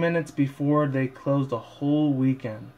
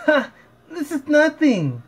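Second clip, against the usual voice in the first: much higher, slower cocky pony shout; Rainbow Dash voice; 14 semitones higher, 4 dB louder, slower than usual. Acted. Fan reading a fanfic, boasting as Rainbow Dash, loud and high. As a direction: brag loudly in a high squeaky filly voice.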